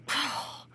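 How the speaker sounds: soft voiceless puff of air; long breathy whoosh; rustling grass. Acted. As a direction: blow out a soft swish. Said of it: "all of the grass said".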